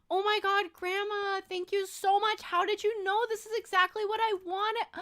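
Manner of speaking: high-pitched acted delight; rehearsed gushing gratitude. Practicing thanks to Grandma for a pretend gift, gushing. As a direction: overact your thrill at the present. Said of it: "Pretend your grandma just gave this".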